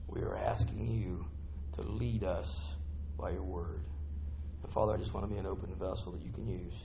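low, soft, earnest prayer voice. Real, not acted. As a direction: pray humbly to the Father, slowing down. Pastor leads opening prayer.

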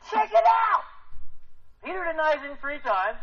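high, loud eager call; excited. Real, not acted. Preacher pointing the class to the verse. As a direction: grab their attention with delight. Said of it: "I get excited about stuff".

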